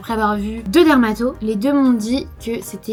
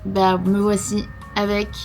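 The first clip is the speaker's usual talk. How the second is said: ordinary talk, nothing marked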